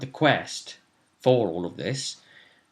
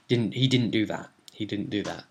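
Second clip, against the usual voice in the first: ordinary delivery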